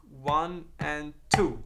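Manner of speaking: drawn-out count, spaced beat by beat; very slow count. Shown as a demonstration. Teacher demonstrates the upstroke, counting very slowly. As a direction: stretch each count apart. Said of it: "That first note I play with the right hand is gonna be an upstroke".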